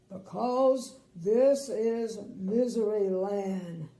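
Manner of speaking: slow, loud, wailing lament; weeping king. Acted. King weeps to Little Miss Sunshine, slow loud wail. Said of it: "wept the king".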